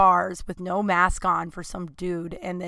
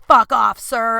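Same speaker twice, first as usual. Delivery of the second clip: mock flip-out; 3 semitones higher, 9 dB louder, slower than usual. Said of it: "when you flip out at someone".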